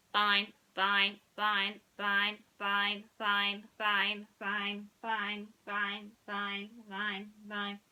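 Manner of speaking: stuck robot voice fading. Acted. Mister Spell keeps repeating fine, robotic and fading. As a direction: let the glitch wind down.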